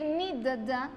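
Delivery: ni da, da said as "nid-da" with doubled D; wrong doubled D. Shown as a demonstration. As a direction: add a second "da" after ni da. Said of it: "Yo no voy a decir". (ni da, da mal dicho, con doble D)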